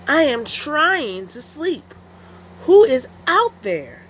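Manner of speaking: high, slow irritated call; grumble, woken from sleep; annoyed wolf. Acted. Wolf woken by noises, slow annoyed call. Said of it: "sat up and said".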